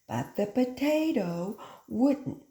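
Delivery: ordinary delivery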